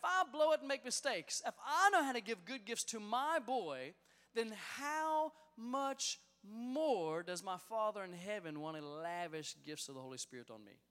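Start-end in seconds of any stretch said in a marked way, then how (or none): 4.3-8.0 slow, drawn-out emphatic phrase; slow weighty sermon build; preaching emphasis. Acted. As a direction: stretch every word of it. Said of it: "preaching builds to key line".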